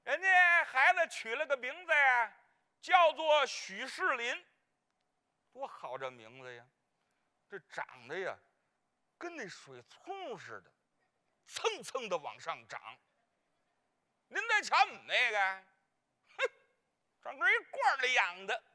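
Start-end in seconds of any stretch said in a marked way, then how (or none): 5.5-12.8 much lower, softer admiring murmur; soft aside. Acted. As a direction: hush it like a fond aside. Praising the other baby softly.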